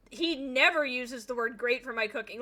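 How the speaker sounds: usual speaking voice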